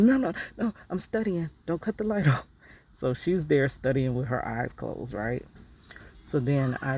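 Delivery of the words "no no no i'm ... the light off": high, insistent half-asleep protest; sleepy mother. Retold or imitated. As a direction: mom refusing to let the light go off. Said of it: "And she's".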